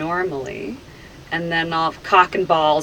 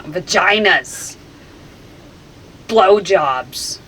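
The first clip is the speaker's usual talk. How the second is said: loud, uncontrolled outbursts; involuntary blurts; 6 dB louder, slower than usual. Real, not acted. Hypnotized subject losing control of words, loud blurts. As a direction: blurt each word out loudly, apart.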